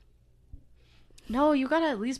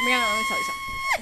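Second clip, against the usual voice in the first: very high and fast; squeaky high voice; 4 dB louder, faster than usual. Pretend. Repeated line in varied voices.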